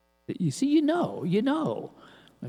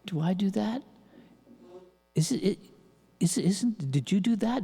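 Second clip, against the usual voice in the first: self-questioning; 4 semitones higher than usual. Retold or imitated.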